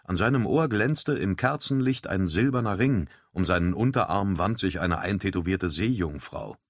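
ordinary delivery

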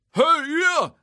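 high, very loud yell; shout. Acted. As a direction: yell to get the crowd's attention. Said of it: "schrie er".

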